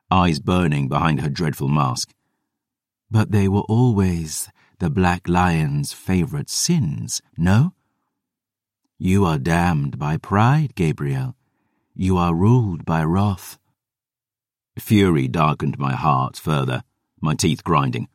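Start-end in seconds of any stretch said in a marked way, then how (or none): 3.1-13.6 slow, even, otherworldly lecture; measured and aloof, deliberately slow; vampire sister rebuking her brother, slow and cool; cold vampire sister. Acted. Selene speaks.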